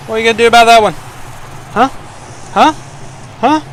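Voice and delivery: high, loud gloating jeer; mock the opponent after the score; taunting. Real, not acted. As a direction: rub it in his face.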